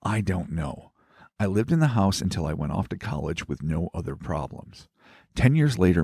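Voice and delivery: low, soft story-reading voice; reading a letter aloud. Acted. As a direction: read it like a quiet ghost story. Reads listener's letter aloud.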